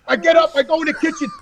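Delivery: normal talking voice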